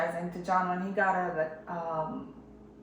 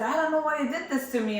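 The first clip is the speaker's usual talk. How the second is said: fast, higher mock-angry accusation; retold anger; 7 semitones higher, faster than usual. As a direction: replay the upset outburst, a notch above her own voice. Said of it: "and I'm angry".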